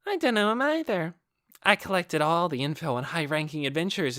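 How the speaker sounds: put-on high, teasing young woman's voice; bright, mocking sing-song delight; a high, teasing, toying voice; playful Clementine. Clementine taunts Ainz.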